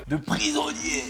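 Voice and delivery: very high, mock squeal; voix aiguë moqueuse. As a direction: tease in a silly high-pitched voice. Taquine le repas de sa compagne. (voix suraiguë pour taquiner)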